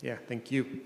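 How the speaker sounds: ordinary talk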